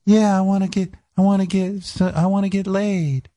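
put-on high falsetto girl voice; boy faking a girl on the phone. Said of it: "I'd be doing a girl voice".

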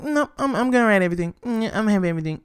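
high, smug mock Tyler voice; high-pitched smug mockery of a mogul. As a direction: play him greedy and self-satisfied. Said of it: "I'm like".

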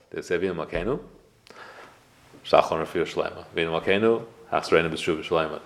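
long pause after "Avinu Malkeinu" before each request. Shown as a demonstration. Rabbi showing congregants how Kelm paused in prayer, long pause after "Avinu Malkeinu". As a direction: let "Avinu Malkeinu" hang, then go on. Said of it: "they would always pause".

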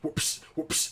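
whispered imitation of belt smacks; breathy whipping sound effects; belt whoops. A dad mimics the belt lashes he heard upstairs, whispered. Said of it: "she kept going".

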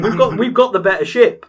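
high, loud, hyped-up retelling; excited retold boast. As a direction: shout it like a triumphant gamer who just realised he has the edge. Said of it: "we're like".

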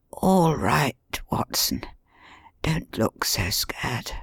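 faint, unvoiced whisper from an injured man; weak wounded whisper; weak mutter. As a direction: barely breathe the words out, slowly. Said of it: "he muttered in a very weak voice".

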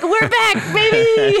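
husband celebrating the new neighbor, laughing; gleeful cheer. Retold or imitated. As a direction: crow it like the party's back on. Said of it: "And Greg's like".

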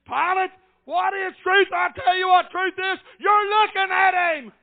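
high, forceful pulpit cry; full-force preaching. Real, not acted. Preacher declaring what truth is, full-force preaching. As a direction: proclaim it loudly to the whole church.

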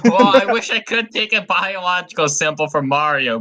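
usual speaking voice

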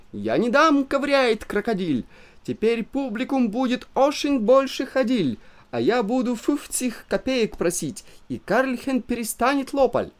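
put-on high, haggling broken Russian in a German accent; a greedy German showman. A narrator's reading. Greedy German owner refuses, haggling in accent.

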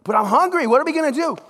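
hungry disciple pleading with friends, rising desperation; mock desperate outburst. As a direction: burst out in hammed-up panic. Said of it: "Peter retold".

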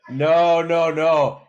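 loud playful no, no, no; loud protest. Real, not acted. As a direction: call him out, loud and laughing. Catches son practicing.

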